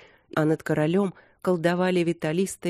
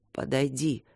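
weak, softer husky voice; Демьян, hoarse wheeze; 3 semitones lower, 5 dB quieter than usual. A narrator's reading. Wounded king summons the rebel baron, hoarse wheeze. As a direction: wheeze out a quiet command. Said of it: "просипел Демьян мятежному Линдмору".

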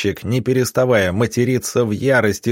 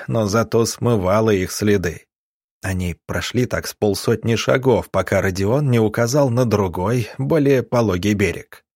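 ordinary delivery

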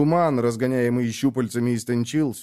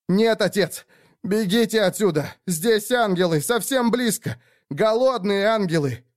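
frantic warning; 9 semitones higher than usual. Acted. Trapped son warns father.

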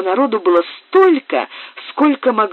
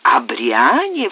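scornful; 6 semitones lower, much slower than usual. A narrator's reading. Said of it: "вскричал отец с резким смехом".

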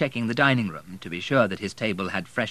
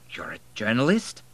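hint of disbelief; 3 semitones higher than usual. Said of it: "with a hint of disbelief".

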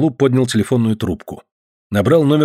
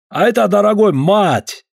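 put-on slow, higher, drawn-out Caucasian accent; 9 semitones higher than usual. Georgian guest chides the late host, slow Caucasian accent. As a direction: stretch each word with a heavy Caucasian accent.

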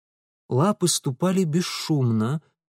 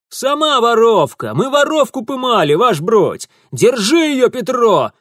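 loud higher yell; barked orders; 9 semitones higher, 7 dB louder than usual. A narrator's reading. Thief yells accusation and barks at Petro. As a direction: bark it at your henchman. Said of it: "рявкнул он".

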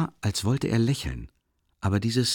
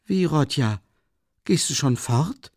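frightened; 7 semitones higher, 3 dB louder than usual. A narrator's reading. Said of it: "richtig erschrocken".

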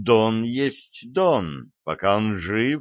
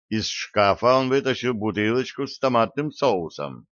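plain reading voice

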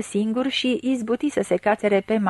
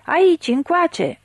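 high, drawn-out call; urging yell; 8 semitones higher, 3 dB louder, slower than usual. A narrator's reading. Crowd on the bank urges a drowning man ashore, urging yell. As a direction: call him over from across the water, stretched and raised. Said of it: "urlau toți îndemnând".

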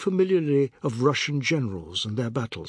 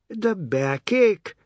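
slow, pained groan of a word; aching widow; 8 semitones higher, 4 dB louder, much slower than usual. A narrator's reading. Widow recalling rice planting, aching. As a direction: say it feeling the ache. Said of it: "arching her back".

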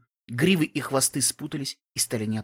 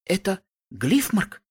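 slow, higher disbelieving question; 5 semitones higher, much slower than usual. A narrator's reading. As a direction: ask it as if you can't believe it. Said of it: "недоверчивым тоном".

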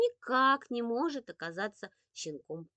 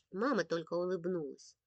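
plain reading voice

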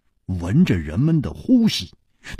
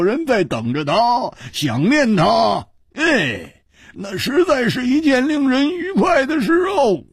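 wax lyrical over the wine; Hu Tiehua, wistful and tipsy; 9 semitones higher than usual. Acted. Plays Hu Tiehua, drunken wistful rise.

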